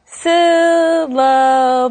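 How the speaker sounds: SYLLABLE drawn out very slowly, sound by sound. Shown as a demonstration. Teacher showing three sounds in that word, "syllable" stretched out. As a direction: stretch "syllable" into three long, separate parts. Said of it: "three different sounds in that word".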